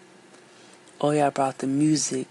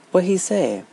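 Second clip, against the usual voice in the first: eager question; 6 semitones higher, 7 dB louder than usual. Acted. Child eagerly asks storyteller.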